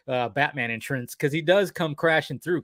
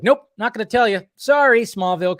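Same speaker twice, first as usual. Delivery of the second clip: higher and louder, playful nyah-nyah refusal; teasing refusal; 8 semitones higher, 6 dB louder than usual. Retold or imitated. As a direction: tease him, you're keeping the secret. Lois refusing to share with Clark.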